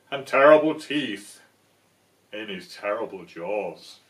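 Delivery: slow, loud, menacing build on terrible; hammed-up monster tale; spooky mouse. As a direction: scare the fox with each terrible.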